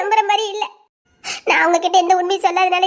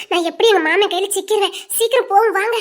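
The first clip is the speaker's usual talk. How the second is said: ordinary talk, nothing marked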